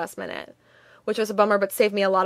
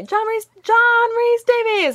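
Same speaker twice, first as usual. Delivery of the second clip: much higher, louder, slow call of the name; 13 semitones higher, 5 dB louder, slower than usual. Retold or imitated. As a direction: call his name so he hears this time. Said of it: "He didn't, he didn't hear me".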